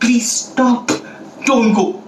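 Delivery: high, pleading storybook shout; desperate little plea, acted out. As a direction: call up to someone far above. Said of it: "they shouted".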